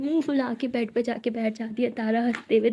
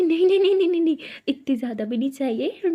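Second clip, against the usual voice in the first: flustered refusal; 3 semitones higher, 3 dB louder than usual. Acted. Tara panicking about locked door.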